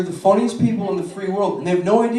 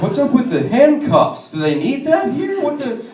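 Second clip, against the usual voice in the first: alarmed question; 8 semitones higher, 6 dB louder than usual. Retold or imitated. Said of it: "I'm like".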